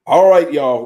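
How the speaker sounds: loud, upbeat call to the room. Real, not acted. Host calling viewers back to the topic, loud. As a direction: grab everyone's attention.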